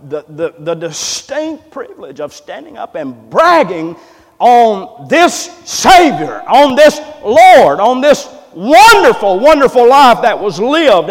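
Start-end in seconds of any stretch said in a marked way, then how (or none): 4.4-11.1 slow, high, loud preacher's cry; preaching at full force. Acted. Preacher bragging on the Savior to the church, slow and loud. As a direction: stretch each word out to the church.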